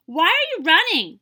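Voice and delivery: loud, high call. Acted. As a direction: call out to the passing herd. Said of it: "she called out to them".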